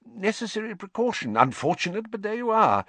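high-pitched, defensive excuse; flustered official justifying himself; uncomfortable. A narrator's reading. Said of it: "said Fudge uncomfortably".

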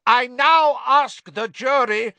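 slow, high, loud courtroom shout. A narrator's reading. Crouch drowns out his son to address jury, shouting. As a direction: shout it over the screaming prisoner. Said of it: "shouted Mister Crouch".

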